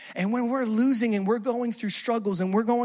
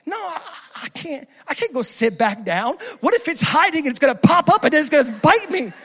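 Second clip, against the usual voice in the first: high-pitched, mounting panic; panicked refusal; 7 semitones higher, 4 dB louder than usual. Retold or imitated. Driver refuses to sit back down with spider, rising panic. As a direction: start high, build to a rushed frantic what-if.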